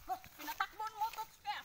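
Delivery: excited. Real, not acted. Horse runs while she eats.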